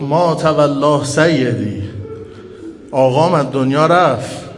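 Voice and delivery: loud, slow, anguished lament; wailing cry of grief. Retold or imitated. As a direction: cry out as a widow hearing of her master's death. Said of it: "فریاد زد". (فریاد سوگوارانه و کشیده)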